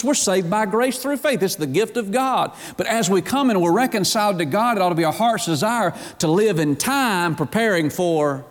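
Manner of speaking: sermon climbing toward fervor; building preacher fervor. Acted. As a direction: lift into full preaching. Rising preaching passage.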